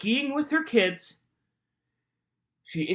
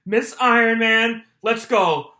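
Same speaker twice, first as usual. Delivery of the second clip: ordinary talk, nothing marked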